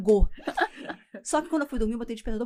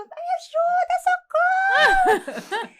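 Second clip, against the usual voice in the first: much higher, loud put-on female cry for help; fake woman shouting; 9 dB louder, much slower than usual. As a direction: scream for help in a falsetto woman's voice. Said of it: "ele gritava". (grito de socorro em falsete feminino)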